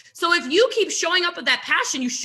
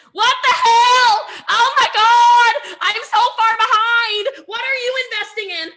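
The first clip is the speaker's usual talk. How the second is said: high, loud, slow mock-panicked yell; panicked shout; 9 semitones higher, 8 dB louder than usual. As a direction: shriek it like you're falling behind. Panicked group chat messages.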